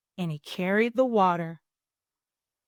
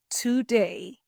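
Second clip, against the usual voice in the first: ordinary delivery, nothing marked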